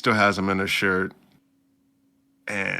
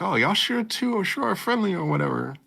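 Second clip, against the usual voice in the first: put-on high teasing girl voice; hammed-up anime girl teasing; mock girly tease; 11 semitones higher than usual. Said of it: "she's like".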